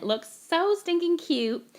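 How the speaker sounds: so stinking cute, stretched high and slow; gushing delight. Real, not acted. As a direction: melt over how cute it is. Gushing over finished honeycomb card.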